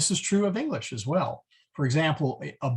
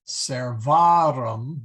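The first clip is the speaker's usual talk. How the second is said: servarum slow and loud, accent on the A; teacher slowly stressing servarum's A; 6 dB louder, much slower than usual. Shown as a demonstration. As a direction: drag out servarum and hit the A. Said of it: "put the accent on the A there".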